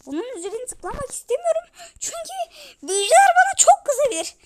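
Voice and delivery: high, whiny mock-scared voice; mock fear. Fears angering the wizards. (yüksek sesli, sahte korkulu sızlanma)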